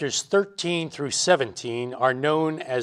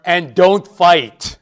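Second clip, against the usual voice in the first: slow, loud, raised-pitch parental warning; emphatic parental command; 4 semitones higher, 8 dB louder, much slower than usual. Acted. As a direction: drive home each word like a parent laying down the law. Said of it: "one command ... that's emphasized".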